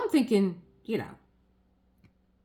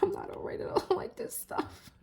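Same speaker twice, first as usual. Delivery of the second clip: mock falling apart; 13 semitones higher, 4 dB quieter than usual. Patient answering the old lady, mock falling apart. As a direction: voice dropping out to a squeaky whisper.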